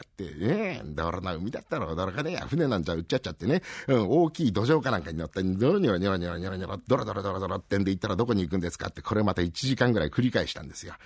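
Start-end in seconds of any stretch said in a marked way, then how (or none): none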